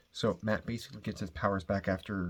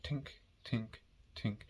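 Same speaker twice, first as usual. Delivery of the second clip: mock tink tink of a cane bouncing down stairs; 3 semitones higher, 7 dB quieter, slower than usual. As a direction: imitate a metal cane clattering away. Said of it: "hearing it go like".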